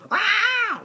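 high loud fearful scream. Retold or imitated. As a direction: shriek like a wasp stung you. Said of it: "I'm screaming like this".

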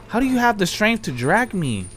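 normal talking voice

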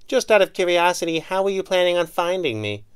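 lightly voiced curious heroine; woman voice. A narrator's reading. Kit voiced, teasing.

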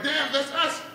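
high, put-on child voice; little girl. Little girl warning her dad, high-voiced. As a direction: pipe up as a child.

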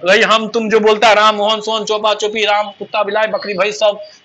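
ordinary talk, nothing marked

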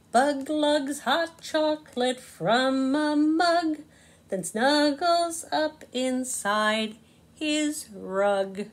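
slow, lilting storybook narration; reading to children. A narrator's reading. Narrator reading Bug in a Rug to kids, slow and sing-song. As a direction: savour each rhyme for little listeners.

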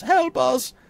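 high, loud cry for help; shouted plea. A narrator's reading. Woman calling to approaching strangers for help, shouted plea.

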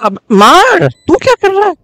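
very high frantic yelling; panicked shout. Real, not acted. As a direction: scream at your teammate in panic. Panicked teammate during last fight.

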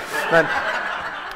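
a short breathy laugh at his own punchline; amused chuckle after the punchline; real laugh. Real, not acted. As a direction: laugh freely at the joke.

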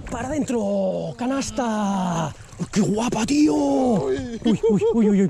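high, drawn-out celebratory cheer rising to squealed "uy"; carried away. Real, not acted. Angler celebrating as the fish goes in, carried away. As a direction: stretch it out, overcome with real excitement. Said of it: "que me emociono aquí".

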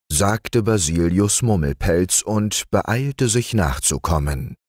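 ordinary delivery, nothing marked

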